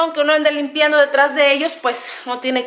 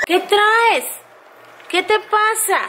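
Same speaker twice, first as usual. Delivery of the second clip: higher, cooing pet talk; baby talk to puppy; 7 semitones higher, slower than usual. Acted. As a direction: coo at the puppy. Talking to the puppy. (hablándole al perrito con voz aguda)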